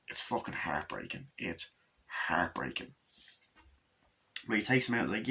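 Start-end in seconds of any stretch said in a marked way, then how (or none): 0.1-2.9 hushed whisper. Real, not acted. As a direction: whisper it, much softer. Sad moment in review.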